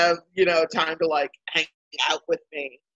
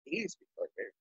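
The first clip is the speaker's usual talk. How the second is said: muttered; 4 semitones lower, 15 dB quieter than usual. Retold or imitated. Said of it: "And I was like".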